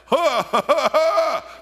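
high, loud, slow ha ha ha; belly laugh. Shown as a demonstration. Preacher demonstrating the doctor's belly laughs. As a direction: show a big belly laugh. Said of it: "I want you to laugh".

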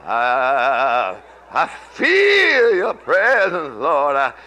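slow, drawn-out prayer rising in intensity; fervent prayer. Acted. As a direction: call out to the Lord, slowly and fervently. Breaks into prayer.

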